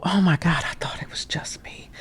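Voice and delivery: hushed, breathy disbelief; relieved whisper. Retold or imitated. Speaker voicing a relieved person, in a whisper.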